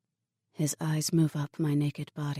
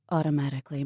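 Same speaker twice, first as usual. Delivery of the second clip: plain reading voice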